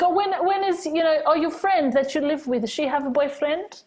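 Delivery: a nosy nonna fishing for gossip; imitating grandmother asking about boyfriends; curious nonna. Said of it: "She's like".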